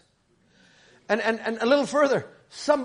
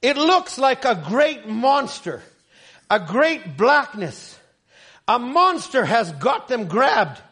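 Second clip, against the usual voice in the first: raised, slow and forceful sermon reading; preaching quote; 4 semitones higher, 5 dB louder, slower than usual. Acted. Preacher reads the quote to the congregation, raised and slow.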